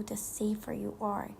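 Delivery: ordinary delivery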